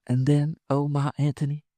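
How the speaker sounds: high, coaxing woman's voice; fondly playful woman. Acted. Woman playing Cleopatra to her lover, high and fond. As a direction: coax him on, high and dreamy.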